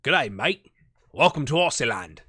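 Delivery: put-on Aussie greeting; Australian accent attempt. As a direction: try a broad mock Australian accent. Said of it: "I don't know what that accent".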